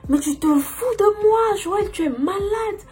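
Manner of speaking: high, furious screaming mother; mom yelling. Retold or imitated. Mother catches her daughter lying, high angry yelling. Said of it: "elle a commencé à crier".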